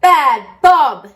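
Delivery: shouting. A narrator's reading. Everyone shouts at Bob the dog. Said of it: "shouted everyone".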